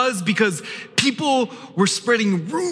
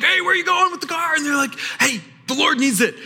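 mock shout; 10 semitones higher, faster than usual. Disciples mimic dealership shout.

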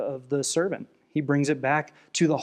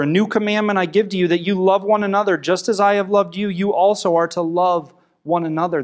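scripture reading; 5 semitones higher, 6 dB louder than usual. Acted. Pastor reading John to the congregation, loud and emphatic.

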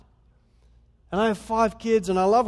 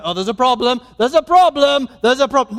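louder, higher mock alarm, building; mock fussy alarm, getting louder; mock fault-finding; 5 semitones higher, 11 dB louder than usual. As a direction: ham up a nitpicking accuser. Mocking a fault-finding God.

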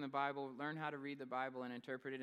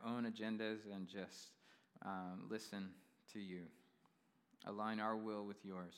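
prayer; 5 semitones lower than usual. Acted. Pastor leads congregation in prayer.

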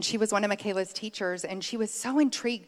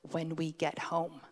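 low threat; 4 semitones lower, 7 dB quieter than usual. Retold or imitated. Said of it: "we would just lean over and go".

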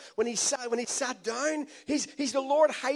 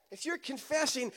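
ordinary talk, nothing marked

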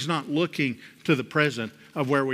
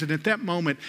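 ordinary talk, nothing marked

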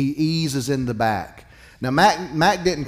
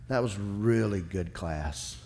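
a hint of an old mentor, slow and a little softer; old mentor's praise; 4 semitones lower, 4 dB quieter, slower than usual. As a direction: praise him calmly like a fatherly elder. Mentor Mac praises him.

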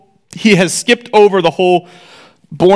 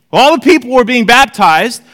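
raised, brisk Bible reading voice; scripture reading; 6 semitones higher, 4 dB louder than usual. Acted. Teacher reading the gospel to the class, lifted reading voice. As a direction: read the passage out to the class.

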